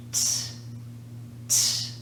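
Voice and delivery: crisp voiceless "t", no vowel after it; isolated T sound. Shown as a demonstration. Teacher showing learners the T sound, isolated voiceless "t". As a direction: say "t" as a bare burst of air, spaced apart. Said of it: "there's no vibration".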